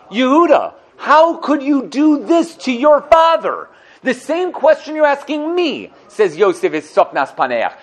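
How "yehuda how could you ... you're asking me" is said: high, loud and slow, flinging it back; a high, slow turnaround rebuke; accusing challenge. Acted. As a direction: throw the question right back in his face. Yosef turns the question back.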